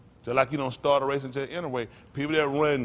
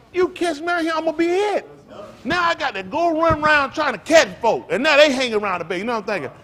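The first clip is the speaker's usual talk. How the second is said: loud and higher, getting carried away; excited storytelling; 12 semitones higher, 8 dB louder than usual. Real, not acted. Preacher to congregation, recalling playground tag, louder and excited. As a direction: relive the game with rising excitement.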